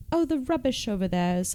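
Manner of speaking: put-on sweet, tender British accent. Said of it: "it was so sweet and tender".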